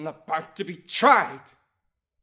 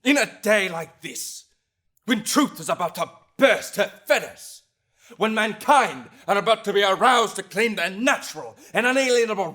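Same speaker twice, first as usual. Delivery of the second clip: raised, ringing courtroom voice; fervent orator at full voice; impassioned oratory; 5 semitones higher, 6 dB louder than usual. Acted. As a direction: drive it forward phrase by phrase, loud and high. Impassioned defense oration in court.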